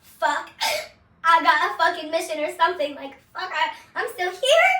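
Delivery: loud, stunned waking-up cry; loud stunned swearing on waking up; shocked disbelief. Retold or imitated. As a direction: blurt it out amazed to be alive. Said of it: "And I'm like".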